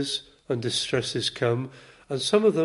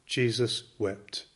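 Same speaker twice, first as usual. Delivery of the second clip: reverent hush; 4 semitones lower, 6 dB quieter, slower than usual. Real, not acted. The shortest verse, read reverently.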